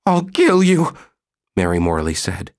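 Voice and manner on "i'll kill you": very high, louder murderous threat; wife threatens husband in rage; furious threat. Acted.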